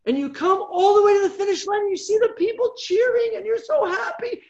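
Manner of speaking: very high, swelling excitement; high-pitched runner's elation; elated storytelling. Retold or imitated. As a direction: soar up high as if crossing the finish line. Retelling the joyful run to the finish.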